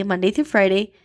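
ordinary talk, nothing marked

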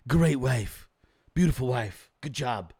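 low boastful Donald Trump take; Trump impression. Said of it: "He's like".

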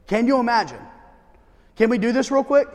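ordinary delivery

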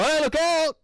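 high, loud mock warning yell; warning shout. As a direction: call out a sudden urgent warning. Warning someone under a nail gun.

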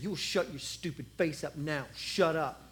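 low, quiet threat; menacing brother. Acted. Big brother warns David, low and quiet.

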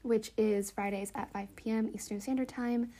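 ordinary talk

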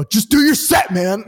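high, loud mock-cocky line; cocky comeback. Comic throwing the heckle back at the performer, cocky. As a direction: throw his own heckle back at him. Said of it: "And I go".

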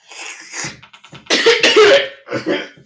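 loud, harsh coughing fit; hacking cough. Acted. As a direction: cough it up violently. Swallowed antidote, coughing fit.

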